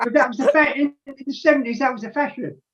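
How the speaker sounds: high, laughing self-defence; amused protest. Real, not acted. Guest defending his seventies hair to teasing host, amused protest. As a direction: protest through amusement, pitched well up.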